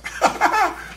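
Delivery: a quick high burst of laughter at his own joke; short high laugh. Real, not acted. Booktuber laughing at his own joke about his audience, short high laugh.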